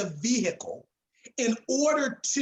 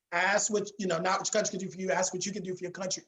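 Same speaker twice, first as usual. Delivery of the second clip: fast and softer, tossed off; rushed casual quote; 2 semitones lower, 4 dB quieter, much faster than usual. Retold or imitated. Coach mimicking how most Americans would quote it, quick and quieter. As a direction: rattle it off the way everyone half-remembers it. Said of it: "ninety percent of Americans who said".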